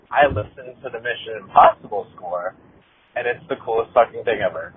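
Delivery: ordinary talk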